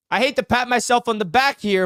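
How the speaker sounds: usual speaking voice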